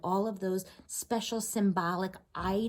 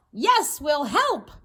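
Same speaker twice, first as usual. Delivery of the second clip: loud, higher, slow group call; 8 semitones higher, 8 dB louder, slower than usual. Acted. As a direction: call it out together, slow and eager. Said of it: "the villagers called out".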